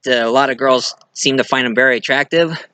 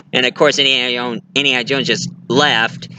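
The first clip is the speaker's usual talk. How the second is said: ordinary talk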